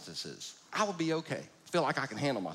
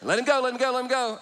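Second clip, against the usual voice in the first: higher, louder urgent calls; mock yell; 9 semitones higher, 7 dB louder, faster than usual. Dad telling his son to release the uncle, mock yell. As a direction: call it out fast, urgently.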